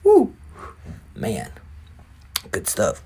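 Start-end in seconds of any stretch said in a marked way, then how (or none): 0.0-0.3 high whoop after a big hit; breathless woo after smoking; loud woo. Real, not acted. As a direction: let out a sharp high woo. Exhaling after a bong hit.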